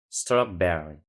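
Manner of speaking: "Strawberry" accented on "ber"; stress on second syllable. Shown as a demonstration. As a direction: shift the emphasis in "Strawberry" to the middle syllable. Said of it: "colocar a entonação na outra sílaba".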